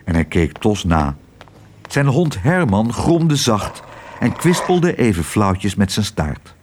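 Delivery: ordinary delivery, nothing marked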